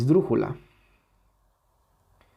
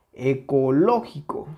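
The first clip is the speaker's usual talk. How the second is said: Ecológico with LO clearly punched; stress on tonic syllable. Shown as a demonstration. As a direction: mark the LO in Ecológico. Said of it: "Dónde tiene la sílaba tónica". (Ecológico con fuerza en LÓ)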